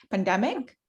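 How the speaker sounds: normal talking voice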